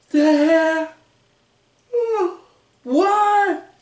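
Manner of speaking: high disbelieving squeak; mock baffled R-Truth. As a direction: play the partner left stunned. Truth baffled as Miz leaves.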